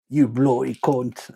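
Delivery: put-on slow Australian accent that slips into British; attempted Australian accent. Streamer retries the insult for chat, shaky Australian accent landing British. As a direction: drag out a jokey Australian insult. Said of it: "that just sounds like British".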